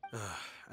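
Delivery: sigh. Acted. Rejecting a pushy salesman.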